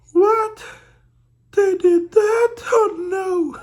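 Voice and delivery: very high, loud, hammed-up disbelief; mock shock. Reader ridiculing the book's claim, loud mock shock. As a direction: squeal in fake horror, breaking into laughter.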